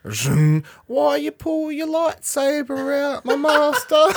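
high, slow, dismayed mock voice; high drawn-out hurt question; mock pleading youngling. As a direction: play the betrayed youngling, hammed up. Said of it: "When the guy's like".

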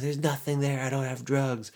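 high, put-on denial; mock protest of innocence. Suspect having his pockets searched, mock high-pitched protest. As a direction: whine the denial in imitation.

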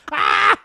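playful high yell; a sudden hammed-up shout; mock yell. Yelling at toy.

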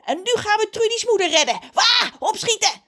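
high, fast, loud war cry; rally them in excited squawks; screaming crow. A narrator's reading. Crow rallies everyone to the rescue, screaming. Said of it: "schreeuwt hij".

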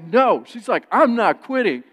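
the wife shoots back at you; loud mock defiance; defiant shouting. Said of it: "she gets in my face".

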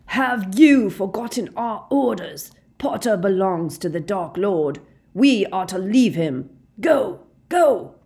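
loud, high, emphatic rebuke; commanding shout. A narrator's reading. Snape rebuking the Death Eaters, shouting. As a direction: shout the orders, climbing to the final command. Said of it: "Snape was shouting".

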